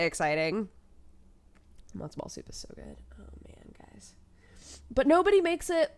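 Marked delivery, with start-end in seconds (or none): 1.9-4.1 hushed, breathy longing; whispered craving. Real, not acted. Longing for matzo ball soup in a whisper.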